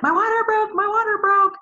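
high, loud panicked yell. Retold or imitated. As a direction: shout it through the house in alarm. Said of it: "And I start yelling".

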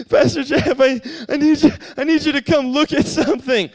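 put-on a weepy boy asking his pastor to come look; crying boy. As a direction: play the upset boy begging for help. Sobbing boy woken at night.

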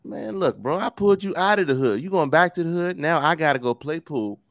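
a hint of an exasperated uncle scolding; lightly voiced uncle scolding his nephew; Uncle Phil. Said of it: "Fresh Prince of Bel-Air".

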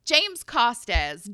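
usual speaking voice

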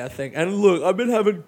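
ordinary talk

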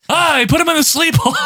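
high-pitched excited commentator impression; mock announcer shout. Co-host joins in mimicking the announcer's pet phrase, excited mock call. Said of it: "I've heard him say this".

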